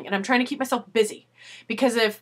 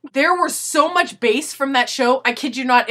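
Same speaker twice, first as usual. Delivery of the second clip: ordinary talk, nothing marked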